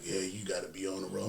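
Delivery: lightly voiced old man; a hint of an old trucker lecturing. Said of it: "I'm doing the old man voice right now".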